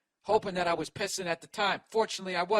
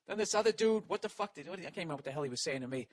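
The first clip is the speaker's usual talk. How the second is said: ordinary talk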